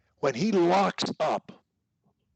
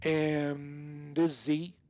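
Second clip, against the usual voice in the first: "And" held very long, running into "Izzy"; connected as one word; much slower than usual. Shown as a demonstration. Coach modelling for the student, connected as one word. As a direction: slowly stretch "And" into "Izzy". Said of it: "I'm connecting it as one word".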